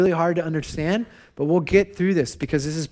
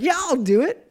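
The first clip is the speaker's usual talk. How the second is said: mock-confident, pitched up high, tossing off the promise; breezy overconfidence; 8 semitones higher than usual. Pastor mimicking his past self agreeing to bedtime, breezy and overconfident. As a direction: play the cocky dad who has no idea what's coming. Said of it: "And so I was like".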